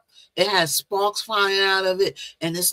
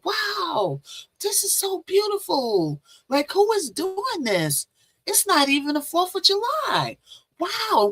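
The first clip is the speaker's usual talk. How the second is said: much higher, wonder-struck voice; awed wow; 12 semitones higher than usual. Retold or imitated. Marvelling at a dream light show with friends, awed and high. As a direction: gasp in amazement at the sky. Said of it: "And we were going".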